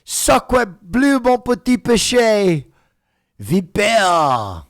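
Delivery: exaggerated French chef accent; hammy French exclamations, high and loud. Mock French outburst at Quebec.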